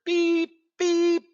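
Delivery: high-pitched mechanical beep, beep; imitated excavator beeping. Mocking politicians as a digging excavator, high imitated beeping. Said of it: "here comes a frickin' excavator".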